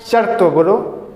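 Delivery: normal talking voice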